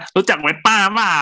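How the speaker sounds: high, loud mock holler; demonstrated shout. Shown as a demonstration. Correcting the joke's delivery, high demo shout. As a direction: holler it out like a show-off rider. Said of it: "เอ้ยมันต้องตะโกนว่า".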